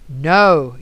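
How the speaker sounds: ordinary delivery, nothing marked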